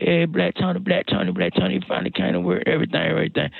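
high excited greeting, calling his name over and over; excited coworkers. Retold or imitated. Coworkers at the office thrilled to see him, excited. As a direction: hype him up like a long-lost friend.

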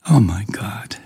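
breathy exasperated whisper, no voice. Real, not acted. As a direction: whisper it in disbelief. Exasperated aside after rant.

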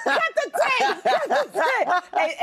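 slow, high, laughing; wheezing with laughter. Real, not acted. Laughing punchline callback.

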